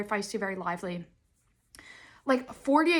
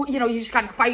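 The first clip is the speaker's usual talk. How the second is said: ordinary talk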